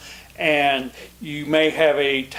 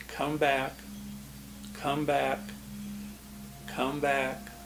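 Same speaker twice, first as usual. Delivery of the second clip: soft, gentle coaxing of the wandering mind; softer, tender repeated call; gentle calling back; 12 dB quieter than usual. Shown as a demonstration. To his own wandering mind in meditation, soft gentle coaxing. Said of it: "I just gently say".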